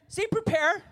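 loud, high prompt for a response; preacher calling the crowd to repeat a word. Real, not acted. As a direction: bark it out so they answer back.

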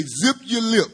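a hint of a scolding judge's order; Judge Judy. Preacher quotes a TV judge to the church, lightly imitated. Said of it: "Oh, Judge Judy used to say".

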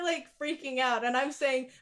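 normal talking voice

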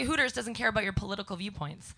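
normal talking voice

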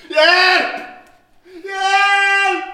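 loud, high, desperate yells; screaming for help. Acted. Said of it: "Jag skriker hjälp".

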